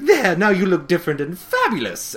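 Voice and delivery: high, loud theatrical flourish; put-on pleased stylist voice; Rarity gushing. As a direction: imitate a diva unveiling a makeover. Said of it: "then Rarity's like".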